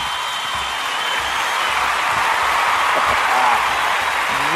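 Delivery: long laugh. Real, not acted. Laughing after hype.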